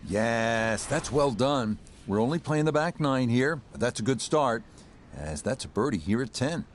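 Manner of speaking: calm live golf play-by-play; golf commentator. Acted. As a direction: call the shot like a TV golf broadcaster.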